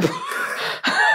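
airy stifled laugh; breathy silent giggle. Real, not acted. Blooper laugh.

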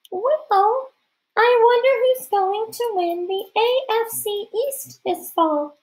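very high, slow falsetto; a boy faking a girl's voice; disguised girl voice. A narrator's reading. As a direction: put on a high girlish squeak, drawn out. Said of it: "forgetting to disguise my voice".

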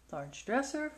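ordinary delivery

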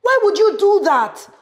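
high, loud reproach; exasperated scolding. Acted. Supervisor scolds Abigail for the mess, loud. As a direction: tear into her.

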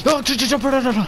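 startled stammer, high and loud; panicked yelp at a jump scare; scared horror game streamer. Real, not acted. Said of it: "game, fucking jump scare me".

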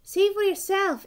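insistent objection to an official; exclaiming. A narrator's reading. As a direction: exclaim it, pushing up in pitch and volume. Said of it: "exclaimed Douglas".

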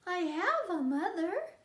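put-on baby bird determined. Baby bird insisting he has a mother, high determined chick voice. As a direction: insist like a stubborn little chick. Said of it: "said the baby bird".

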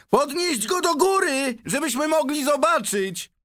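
high, loud crowd shout; calling out. A narrator's reading. Onlooker demands the boy be lifted up, calling out. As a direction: shout to be heard from the back. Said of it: "wołał ktoś".

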